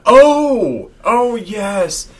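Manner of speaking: high, loud caught-out sputter; spluttering outrage. Retold or imitated. Liar caught about the cat.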